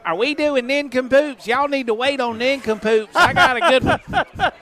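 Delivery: high Southern grandma impression; Jackson. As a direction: imitate an excitable old Southern granny. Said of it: "that's my Jackson impersonation".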